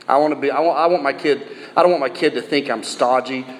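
ordinary delivery

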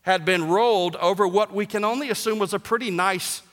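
ordinary talk, nothing marked

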